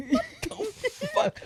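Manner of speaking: very high, drawn-out amused squeak; mock-stunned through laughter; retold laughing disbelief. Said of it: "And I'm like".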